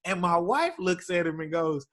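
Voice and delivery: usual speaking voice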